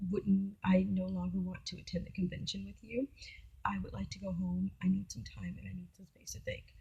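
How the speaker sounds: whispered, firm request to go home; quiet and steady, wanting out; whispered plea for space; hushed request. Retold or imitated. Asking to leave, whispered.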